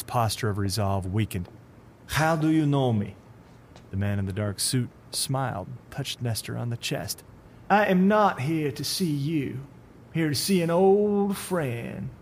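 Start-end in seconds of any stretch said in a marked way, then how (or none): 2.1-3.1 put-on higher Russian accent, unsettled; Russian accent, suddenly unsure. Supervisor asks the stranger how he knows him, in a Russian accent. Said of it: "Russian accent". 7.7-12.1 higher, slow and silky; creepy stranger. Acted. The man in the dark suit speaks to the supervisor in a slow, higher, creepy voice.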